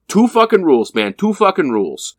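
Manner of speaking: high, emphatic rule-setting; agitated goalie. Retold or imitated. Goalie lays down rules for the new player, agitated. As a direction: lay down the law, worked up.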